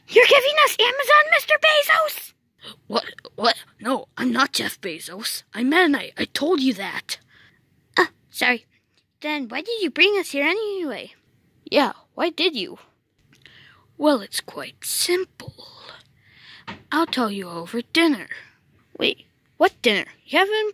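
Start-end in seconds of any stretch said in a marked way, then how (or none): 0.1-2.3 much higher, fast squeaky voice; squeaky character. Acted. 14.0-18.3 hushed reply. Acted. Meta Knight whispers explanation.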